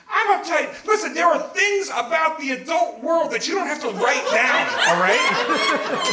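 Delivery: high-pitched, worked-up lecture; indignant rant. Acted. Son-in-law lecturing her on the adult world, exasperated. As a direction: rant as if stung by the accusation.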